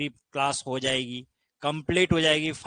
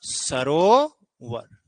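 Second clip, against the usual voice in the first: Sarovar stretched very slowly, syllable by syllable; teacher spells out Sarovar slowly for the class; drawn-out demo word; 2 semitones lower, much slower than usual. Shown as a demonstration. Teacher reveals the answer Sarovar to students, stretched very slowly. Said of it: "और बताओ क्या बनेगा".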